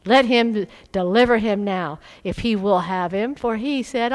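loud, forceful reading of the mockers; loud scripture reading. Preacher reading the crowd mocking Jesus, loud and forceful. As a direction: boom out the scripture.

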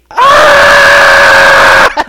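high, loud release scream. Retold or imitated. Girl alone in her car screaming to let off stress. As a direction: let it all out in one scream. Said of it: "she was just".

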